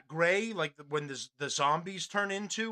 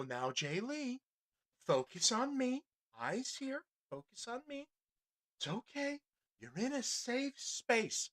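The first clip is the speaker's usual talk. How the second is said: high, soft, patronizing calming voice; mocking soothing administrator; 6 semitones higher, 8 dB quieter than usual. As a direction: coo at him like a scared child. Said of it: "This administrator has to come over and go".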